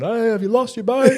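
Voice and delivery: high, silly impression of the puzzled camper; mocking replay. Mates replaying the campers' question, high mocking voice. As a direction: ham up the camper's odd question.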